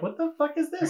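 high-pitched, incredulous question; baffled disbelief. Retold or imitated. Storyteller finding a tiny door on the roof, baffled. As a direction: squeak it out in puzzled disbelief.

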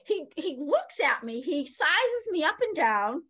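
normal talking voice

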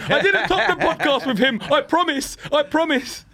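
high, protesting plea with a laugh in it; mock pleading. Comic swearing to a doubter he knows Tony D, pleading.

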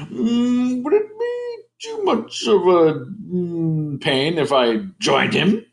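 high, hemming pretend guest; character voice sliding down from very high; Barry. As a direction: ask politely in a put-on high voice. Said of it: "his name is Barry".

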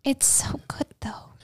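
soft whispered aside. Real, not acted. Host urging listeners to watch, whispered. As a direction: whisper it like a secret tip.

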